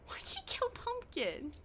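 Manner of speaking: a hushed, stunned whisper. Real, not acted. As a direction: murmur it in disbelief. Stunned whisper after pet dies.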